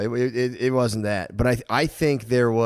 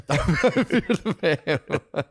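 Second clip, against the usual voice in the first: laughing through the words, drawn out; 5 semitones higher, much slower than usual. Real, not acted. Friends joking about a diet coach, laughing. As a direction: breaks into laughter mid-line.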